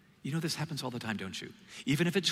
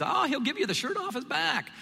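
much higher, gushing voice; mock admiring quote; 13 semitones higher, 3 dB louder than usual. Quoting the folksy phrase people use, much higher and gushing. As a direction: play someone gushing over a generous friend.

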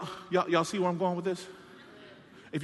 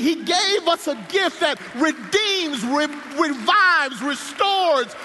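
rising, louder rhythmic preaching; sermon climax, each line stacking higher; preaching at full force; 5 semitones higher, 4 dB louder than usual. Acted. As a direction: build the run like a revival. Building preaching cadence.

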